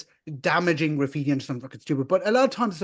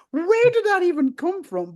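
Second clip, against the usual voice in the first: baffled; 12 semitones higher, 4 dB louder, slower than usual. Retold or imitated. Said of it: "I'm like".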